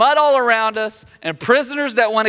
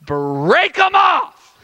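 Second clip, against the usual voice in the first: high, drawn-out shout; hammed-up desperate yell at the chains; desperate command; 7 semitones higher, 5 dB louder, much slower than usual. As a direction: bellow it slowly, stretching BREAK.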